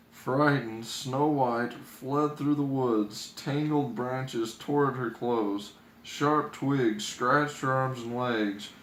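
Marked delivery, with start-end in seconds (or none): none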